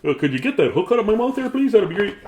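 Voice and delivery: a cheery fish greeting the fisherman; chatty fish voice. Pretend. Fish pulled up on a hook, greeting the fisherman in a high cheery voice. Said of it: "he's like".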